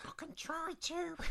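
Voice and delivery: tiny, very high soft voice; snail voice. Pretend. Narrator answers listener's request, squeaky snail voice. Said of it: "in that snail voice".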